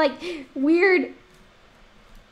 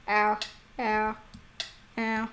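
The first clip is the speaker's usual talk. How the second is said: ordinary delivery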